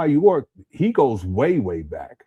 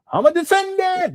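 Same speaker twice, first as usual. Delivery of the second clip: sudden much higher mock shout; imitating Umar Johnson's much higher screaming delivery; imitating Umar's scream; 3 dB louder than usual. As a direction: ham up his trademark yell. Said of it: "especially when he be screaming".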